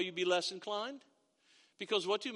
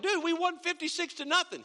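indignant players; 9 semitones higher than usual. Retold or imitated. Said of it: "And we're like".

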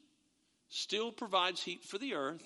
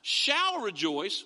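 "Shall" drawn out slowly with a rise; stress on shall; 5 dB louder, much slower than usual. Shown as a demonstration. Preacher explaining future tense, slow stress on "Shall". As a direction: lean on "Shall" to mark the future. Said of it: "which is a future statement".

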